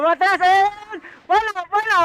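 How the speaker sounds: gleeful high call to the team; excited shout. Real, not acted. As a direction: voice jumps high and loud as they fall back. Excited reaction to enemy retreat.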